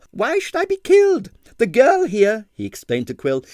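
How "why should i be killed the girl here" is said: high, amused disbelief; young dancer scoffing at the fear. A narrator's reading. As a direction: laugh off the danger. Kasia scoffs.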